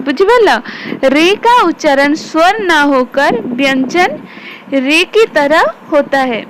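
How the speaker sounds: slow, deliberate dictation pace. Shown as a demonstration. Teacher dictating notes slowly to pupils. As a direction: dictate each word for notes. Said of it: "dictating notes slowly".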